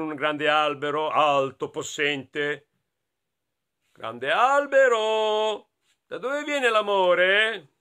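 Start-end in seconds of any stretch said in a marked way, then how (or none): 3.9-7.7 slow, drawn-out, higher-pitched call. Acted. Friends questioning the great tree, slow raised call. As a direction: stretch it out toward a towering tree.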